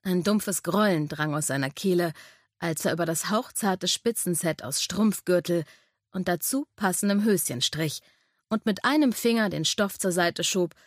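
ordinary delivery

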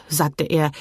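plain reading voice

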